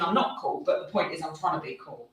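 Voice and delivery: normal talking voice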